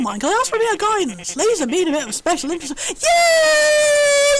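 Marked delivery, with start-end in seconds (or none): 2.9-4.4 high, loud happy squeal; excited shout. Real, not acted. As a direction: cheer it out.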